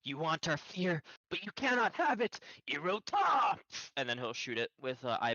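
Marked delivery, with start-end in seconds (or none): 0.0-3.6 high declamation building to a shout; hammed-up heroic battle challenge; defiant hero cry. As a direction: hurl defiance at the enemy, rising in pitch. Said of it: "John would say".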